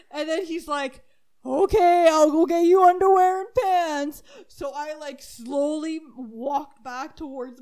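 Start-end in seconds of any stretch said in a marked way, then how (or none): 1.4-4.2 louder, somewhat higher resigned reply; resigned dad. Retold or imitated. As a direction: give in like a tired dad. Dad agreeing.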